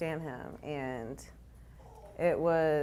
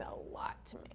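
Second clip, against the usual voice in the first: soft, moved whisper; 14 dB quieter, faster than usual. Real, not acted. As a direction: breathe it out softly. Emotional tribute to friends.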